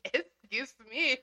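drawn-out copy of a polite call; slow mock "excuse me". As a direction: stretch it out slowly, teasing.

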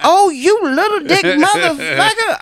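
high, loud mocking taunt; mock woman's insult. Woman insulting a man, high and loud mocking. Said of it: "a woman saying".

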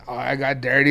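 low, soft, offhand mimicry of a kid; casual. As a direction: quote a kid who thinks it's no big deal. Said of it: "you say so casual".

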